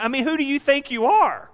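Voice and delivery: high-pitched, mocking put-down; who does he think he is, scoff it; the woman mocking the stranger, scornful; scornful challenge. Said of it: "That's what she's saying to him".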